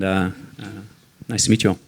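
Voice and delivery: ordinary talk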